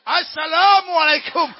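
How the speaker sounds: loud, high, drawn-out greeting; slow, booming acted reply; hearty called greeting. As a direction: sing out the greeting proudly across the distance. Soldier answers, punchline.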